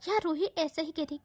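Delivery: usual speaking voice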